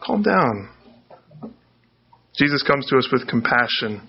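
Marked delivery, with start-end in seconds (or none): 0.0-0.7 soft, calming repetition of her name; a touch of reassuring calm; soothing. Acted. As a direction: settle her down gently. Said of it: "Jesus comes and he says".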